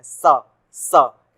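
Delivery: सा with a clear dental s, not sh; सा said loud, tongue at the teeth. Shown as a demonstration. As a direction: hiss the सा against the teeth. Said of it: "इसमें हम सा बोलते हैं".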